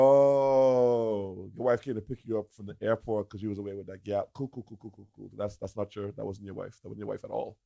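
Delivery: hushed low confession voice; low muttered reveal. Retold or imitated.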